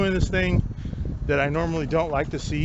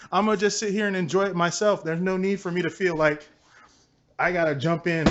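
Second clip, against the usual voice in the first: normal talking voice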